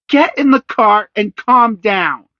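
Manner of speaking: high, loud, slow mother; mother orders her son; stern order. A narrator's reading. Mother scolding.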